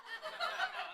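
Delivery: soft falsetto giggle; high laugh. Real, not acted. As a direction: let a light laugh slip out high. Laughs at own joke.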